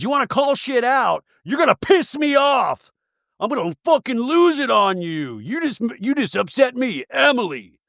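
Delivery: high-pitched, hammed-up threat; mock angry rant. Emily warning Gretchen she'll lose it, mock angry. Acted quarrel, Emily losing it.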